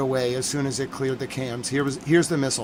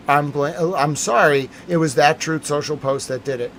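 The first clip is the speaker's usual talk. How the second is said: ordinary delivery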